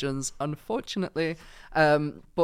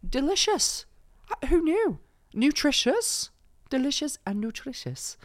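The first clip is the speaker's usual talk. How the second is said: higher, bright mock advert voice; 10 semitones higher than usual. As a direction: sell it like an influencer ad.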